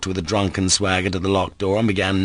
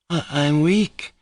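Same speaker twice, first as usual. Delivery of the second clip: higher, slow, drained voice; faltering as the strength goes out of her; exhausted; 9 semitones higher, slower than usual. A narrator's reading. Grushenka swaying after her dance, exhausted. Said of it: "she said in an exhausted voice".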